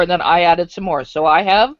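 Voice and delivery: ordinary delivery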